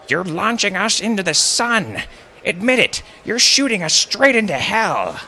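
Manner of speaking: put-on very high, loud old woman's screech; screeching like a crow. A narrator's reading. Old woman accusing her family at the launch, screeching. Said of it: "screeching like a crow".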